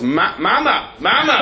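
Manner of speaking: high, climbing mama with a laugh; mangled ma'am. Retold or imitated. Man calling the young teller mama, shooting up high. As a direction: fumble the word, voice shooting up. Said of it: "he goes".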